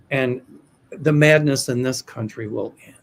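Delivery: ordinary talk, nothing marked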